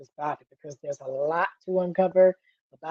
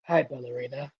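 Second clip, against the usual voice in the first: low deadpan Keanu; 5 semitones lower, 5 dB quieter than usual. Pretend. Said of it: "on the screen like".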